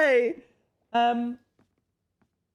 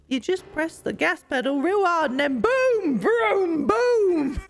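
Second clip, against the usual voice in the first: louder, higher, racing-car excitement; hyped car noises; 6 semitones higher, 3 dB louder, slower than usual. Acted. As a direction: shout like flooring the gas pedal. Voicing a villager's car story.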